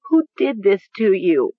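tearful, drawn question; whimpering. A narrator's reading. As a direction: ask it in grief. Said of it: "she whimpered".

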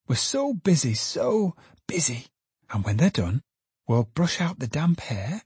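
put-on cooing and bustling over the child; tender daughter Meg.

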